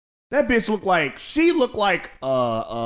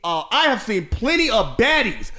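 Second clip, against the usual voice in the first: excited rant; 7 semitones higher than usual. Real, not acted. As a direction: rant it all worked up. Heated rant about baddies.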